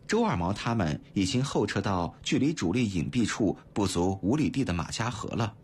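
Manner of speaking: ordinary delivery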